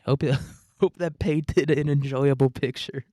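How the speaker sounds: chuckling through the words; laughing. Real, not acted. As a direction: laugh while talking. Laughing at own story.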